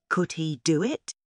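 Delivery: ordinary delivery, nothing marked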